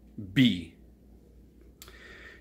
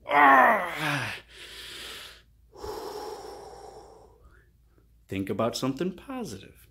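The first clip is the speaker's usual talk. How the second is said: calm reset; 5 dB quieter than usual. Acted. Calming shift to positive.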